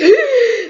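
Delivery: sharp high gasp for air; panicked gasp. Retold or imitated. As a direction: gasp like you can't breathe. Crawling, unable to breathe.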